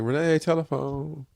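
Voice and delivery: mild imitation of the mother; mother calling out. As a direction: call her daughter to the phone. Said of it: "she's like".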